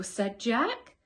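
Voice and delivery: plain reading voice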